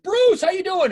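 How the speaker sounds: high, loud delighted cry; joyful greeting. Retold or imitated. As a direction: greet an old friend with delight. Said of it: "I was like".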